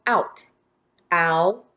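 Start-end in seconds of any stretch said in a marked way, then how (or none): 1.1-1.7 "Out" segmented as ow, then a separate t; sound by sound. Shown as a demonstration. Teacher has pupils listen to the sounds, segmented phonemes. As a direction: sound out "Out" in two isolated phonemes, ow, t. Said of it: "listen to the sounds in the first syllable".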